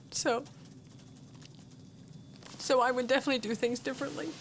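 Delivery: a mother fighting to keep her voice steady; emotional. Real, not acted. Mother speaking to the parents, near tears. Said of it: "pardon me if I get a little emotional".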